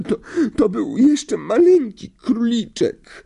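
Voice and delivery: very high, slow, stammered wail; sobbing. A narrator's reading. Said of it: "załkała Lavender". (łkając, piskliwie)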